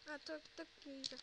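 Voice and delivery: ordinary talk, nothing marked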